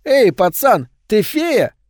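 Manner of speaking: high, loud taunting pitch; jeering bully. A narrator's reading. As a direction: tease him with a squeaky, mocking lilt. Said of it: "передразнил тонкий голос Томми".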